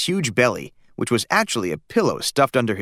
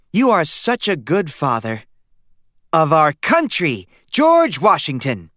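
mother's voice; 5 semitones higher, 4 dB louder, slower than usual. A narrator's reading. Mother admires father in costume.

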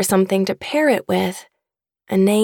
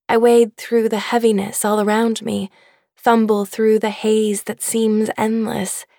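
usual speaking voice

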